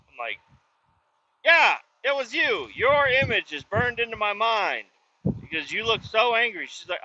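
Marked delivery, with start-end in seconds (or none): 1.5-6.7 high insistent accusation. Retold or imitated. Accusing guard in retold scene.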